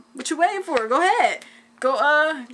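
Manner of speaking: loud, high urging from dad; dad pushing the doctor to hurry; urgent dad. Retold or imitated. Dad urging the doctor to get going, loud and high. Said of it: "My dad was like".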